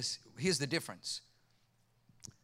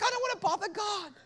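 timid excuse; 12 semitones higher than usual. Retold or imitated. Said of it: "So I'm like".

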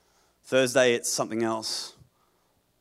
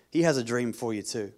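ordinary talk

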